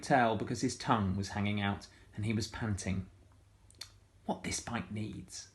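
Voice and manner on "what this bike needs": soft catchphrase. A narrator's reading. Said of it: "She's got a kind of catchphrase".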